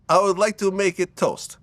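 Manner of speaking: "make it toast" in a light Russian accent. Shown as a demonstration. Showing how Russians put it in the wrong place, light Russian accent. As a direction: stress the stray "it" in "make it toast", Russian style. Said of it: "you have to put an it in the wrong place".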